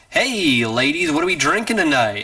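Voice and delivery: imitating a bold pickup greeting; confident flirt. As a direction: hail the women like a cocky flirt. Imitates confident man greeting the women.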